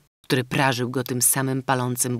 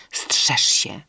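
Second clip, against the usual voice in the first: whispered warning with her last breath; dying whisper. A narrator's reading. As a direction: breathe it out as she dies. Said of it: "Nieznajoma zdołała tylko rzec".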